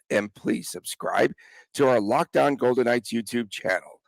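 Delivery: ordinary talk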